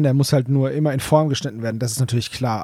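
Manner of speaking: ordinary talk, nothing marked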